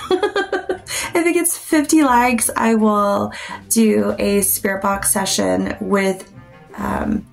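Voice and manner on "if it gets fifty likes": laughing through the words. Real, not acted. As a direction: giggle it out, high and slow. Laughing while repeating her offer.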